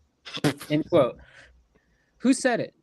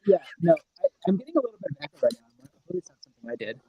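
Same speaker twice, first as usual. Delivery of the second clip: hydrophone underwater voice; 2 semitones higher, 3 dB louder, much faster than usual. Real, not acted. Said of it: "You sound like you're underwater a little bit".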